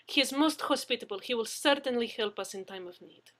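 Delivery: put-on Russian fiancée praising Brewer, in a Russian accent. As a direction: gush in a thick Russian accent. Russian woman character held.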